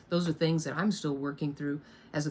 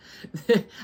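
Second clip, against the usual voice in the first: high, loud chuckle; real laugh; 5 semitones higher, 7 dB louder than usual. Real, not acted. Dodging the story of her father, loud real laugh. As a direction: laugh it off before carrying on.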